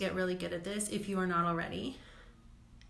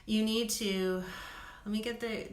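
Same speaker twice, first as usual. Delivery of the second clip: normal talking voice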